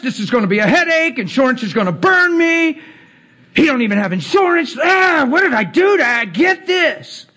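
high, frustrated complaining that grows to a shout; mock distressed rant. Driver after crash ranting on the phone, mock frustrated shout. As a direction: imitate a driver cursing his bad luck. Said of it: "they're distressed".

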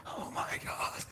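whispered, drawn-out imitation of overwhelmed awe; acted awestruck whisper; trembling awe. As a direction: whisper it slowly as if overcome. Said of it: "trembles, and says".

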